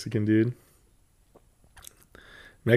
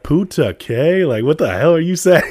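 loud, high-pitched mock outburst; exasperated bewilderment; 8 semitones higher, 9 dB louder than usual. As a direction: cry out in hammed-up confusion. Acted bewilderment at rapid Spanish.

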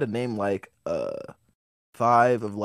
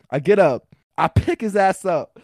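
normal talking voice

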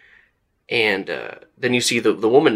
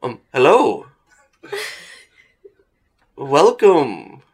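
raised, louder theatrical greeting; pompous actor; 5 semitones higher, 5 dB louder, slower than usual. Acted. Actor surprised by an audience, pompous greeting. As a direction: greet them like a grand stage star. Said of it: "he's so pompous looking".

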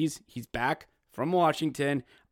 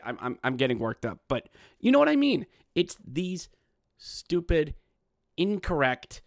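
usual speaking voice

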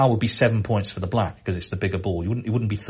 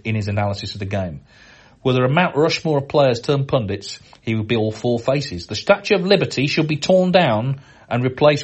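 raised, grandly overblown praise, half-joking; mock-epic reading; 3 semitones higher, 4 dB louder than usual. Host reads an over-the-top fan tribute, mock-epic. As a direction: read the tribute like a pompous proclamation. Reads listener's letter aloud.

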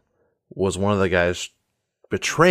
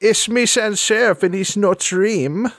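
put-on higher, theatrical accented villainess; Sans Serif; 12 semitones higher, 5 dB louder than usual. Assuring him it's no dream, theatrical accented voice. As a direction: slur the s sounds toward sh, pitched up. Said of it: "I've missed your voice so much".

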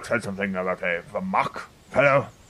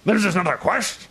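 impatient shout; 9 semitones higher than usual. Acted. Rousing sleepers, banging armor.

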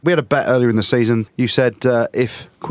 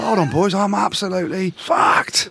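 out of breath; 8 semitones higher, slower than usual. Retold or imitated. Player retold, out of breath.

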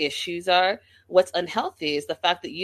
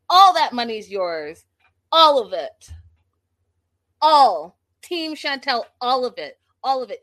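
louder and louder, punching each all; emphatic; 4 semitones higher, 7 dB louder than usual. Real, not acted. Urging Chantel to keep all the money, building emphatic. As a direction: build it up like a rallying cry.

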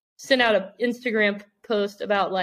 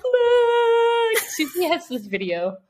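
imitated fan meltdown; 11 semitones higher, much slower than usual. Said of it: "she falls on the floor".

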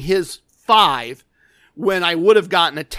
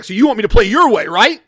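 louder, fast mock-angry challenge; imitating anger; 3 semitones higher, 8 dB louder, faster than usual. Angry gambler challenging the man laughing at him, retold anger. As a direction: throw it back fast, imitating a mad player. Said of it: "now he's really mad".

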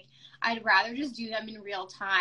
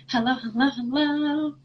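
sing-song greeting; 3 semitones higher, 6 dB louder, slower than usual. Real, not acted. Greeting guests joining live.

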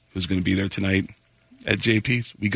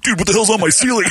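alarmed host; 9 semitones higher, 3 dB louder than usual. Retold or imitated. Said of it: "He goes".

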